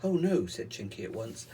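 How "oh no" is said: firm refusal. Acted. Chinky refusing.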